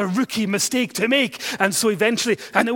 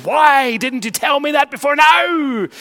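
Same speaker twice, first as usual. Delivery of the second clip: louder and higher, slow exasperated outburst; imagined scream; 7 semitones higher, 8 dB louder, slower than usual. Retold or imitated. Teacher imagines yelling at the boy, exasperated shout. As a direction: pretend to yell at the child in frustration. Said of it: "all you want to scream at the child is".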